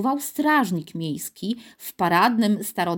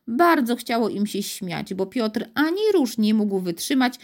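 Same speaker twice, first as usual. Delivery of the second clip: ordinary delivery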